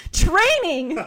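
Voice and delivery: high excited shout. Acted. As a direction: shout it out with gusto. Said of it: "shouted Naruto".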